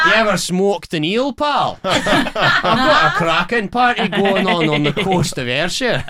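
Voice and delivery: playful Glaswegian patter; Scottish accent. Pretend. Glasgow local invites a visitor to an eel party, Scottish accent. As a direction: put on a chummy Scottish accent. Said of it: "Doing the Scottish accent".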